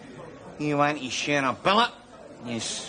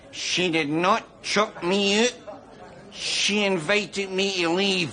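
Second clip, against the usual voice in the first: slow, higher-pitched, emphatic denial; indignant correction; 5 semitones higher, slower than usual. Acted. Man correcting friends about his break-up, indignantly. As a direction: set them straight, word by slow word.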